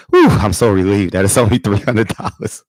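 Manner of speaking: huge relief in the voice; real relief. Real, not acted. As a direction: let the relief burst out, ending on a high "oh, man". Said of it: "you hear it in my voice".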